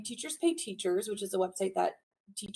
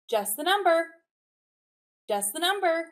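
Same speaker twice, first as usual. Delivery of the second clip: call and echo; 7 semitones higher, 5 dB louder than usual. Shown as a demonstration. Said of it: "I'll say it, you respond".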